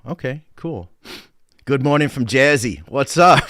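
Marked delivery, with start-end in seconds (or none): 1.7-3.4 put-on playful Jersey accent, louder and punchy; hammed-up Jersey greeting. Host greeting a viewer from Jersey in a jokey Jersey accent. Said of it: "I had to do the accent".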